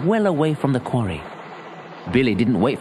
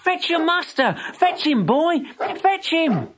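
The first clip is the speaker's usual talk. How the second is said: high urgent calls up the quarry; calling out; slower than usual. A narrator's reading. Billy calling to the dog at the quarry top, high and urgent. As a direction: call the dog from far below. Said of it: "Billy called".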